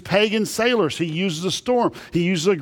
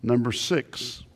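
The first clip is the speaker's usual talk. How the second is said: ordinary talk, nothing marked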